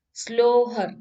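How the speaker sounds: loud captain's call; shouted order. A narrator's reading. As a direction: call the command out to the crew. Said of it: "he shouted".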